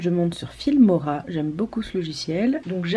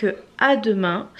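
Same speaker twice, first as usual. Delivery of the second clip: normal talking voice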